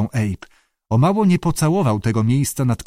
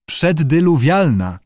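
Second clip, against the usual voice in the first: slow, higher "Przeddyluwialna"; careful pronunciation; 4 semitones higher, slower than usual. A narrator's reading. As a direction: enunciate the long word slowly. Judy offers the hard word.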